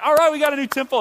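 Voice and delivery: mock cheer. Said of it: "some of the people went".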